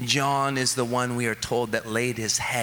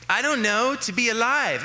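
put-on much higher, louder little kid; child's voice; 11 semitones higher, 4 dB louder than usual. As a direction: pipe it up like a small child. Child's answer voiced by dad.

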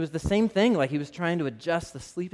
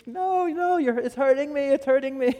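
put-on much higher, whiny child's protest; imitating a screaming toddler; 9 semitones higher than usual. A dad imitating his son pleading while a sliver comes out, high whiny cry. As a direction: play the little boy begging dad to stop. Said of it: "and he's screaming".

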